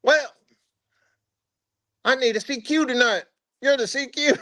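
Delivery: spring the duty on him with a grin; imitating the first sergeant. First sergeant assigning night duty to a non-member, high smug voice.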